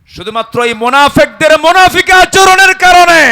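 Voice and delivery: high, loud denouncing cry; sermon climax shout; fiery shout. Acted. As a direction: condemn them at full force. Denouncing hypocrites.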